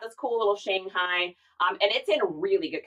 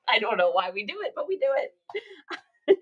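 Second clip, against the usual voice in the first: ordinary talk, nothing marked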